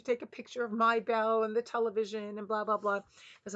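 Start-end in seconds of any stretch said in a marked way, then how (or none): none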